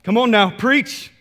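loud, high congregant cheering the preacher on; hyped-up amen-corner shout; engaged churchgoer. Acted. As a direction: call out encouragement to the pulpit. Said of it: "You're giving him feedback and you're just like".

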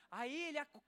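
ordinary delivery

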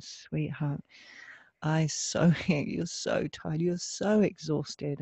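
soft and slow, soothing; inner loving mother. Retold or imitated. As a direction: comfort a tired child slowly. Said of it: "my inner loving mother, saying".

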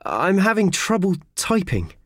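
shivering. Acted. Cold clerk complaining.

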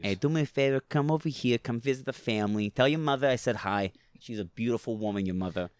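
put-on lightly done New York Italian mobster accent, easy and chummy; New York Italian gangster accent. Mobster sends his regards to your mother, New York Italian accent. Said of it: "New York gangster Italian accents".

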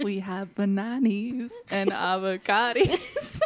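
slow, laughing singer impression; breathy quirky singer, laughing; indie girl singer voice. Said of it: "You know what she sounds like?".

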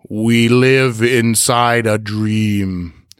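every word stretched out slowly; slow, dreamlike quote. Acted. Host quoting Coop's superimposed head, slow and drawn out. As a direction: draw it out like a slowed-down voice.

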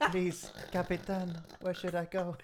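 high, slow mock plea of an old lady; hammed-up old woman begging the captain; playful Titanic old lady impression. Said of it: "look like the lady at the end of, uh, Titanic".

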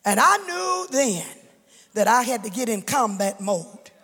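gather strength for the fight; rising preaching. Real, not acted. Preacher to congregation, building toward the battle.

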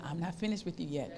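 very quiet and low; soft tender God. Acted. God tells the struggling believer tenderly, softly. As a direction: promise it gently, almost whispered.

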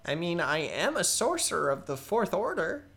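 high, loud and chatty goblin voice; young goblin sorcerer introducing himself; eager young goblin. Acted. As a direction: ramble on like a nervous young goblin. Goblin Balthazar voiced throughout.

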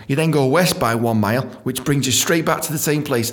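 normal talking voice

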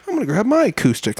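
eager joiner. Retold or imitated. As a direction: act out someone keen to join in. Acting out eager jam joiner.

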